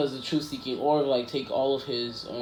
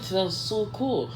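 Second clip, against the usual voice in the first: high, drawn-out gushing; mock admiration; 6 semitones higher, much slower than usual. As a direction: gush slowly and high, as an imitated fan. Retold girl admires the quirks.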